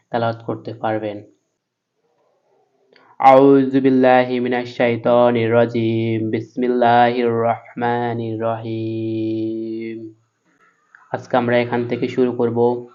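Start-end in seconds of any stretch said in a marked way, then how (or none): 3.2-6.3 slow, measured chanted recitation; Quran recitation. Acted. Teacher opening a Quran lesson, recites slowly. 6.3-10.1 very slow, drawn-out chanted recitation; Quran recitation. Acted. Teacher opening a Quran lesson, chants slowly.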